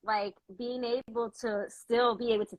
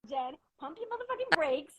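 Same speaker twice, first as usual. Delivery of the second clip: higher, a touch of a firm warning; mock stern friend; 7 semitones higher, 5 dB quieter than usual. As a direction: rein her in like a strict friend. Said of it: "she will chin check me".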